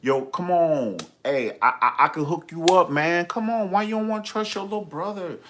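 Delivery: mock pleading brother; imitating brother. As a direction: brother talking her into a haircut, coaxing. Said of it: "you talking all slow and shit".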